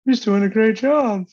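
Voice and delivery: high, loud, slow dopey voice; high drawn-out mock praise in a dumb voice. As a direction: ape a clueless supporter. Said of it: "these stupid shits".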